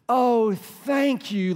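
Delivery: sleepy grateful thanks. Retold or imitated. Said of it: "like it's the first time I've ever done it".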